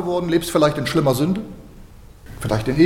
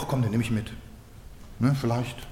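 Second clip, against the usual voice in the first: hesitant thought; 7 semitones lower, 11 dB quieter, faster than usual. Retold or imitated. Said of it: "Und da hab ich gesagt".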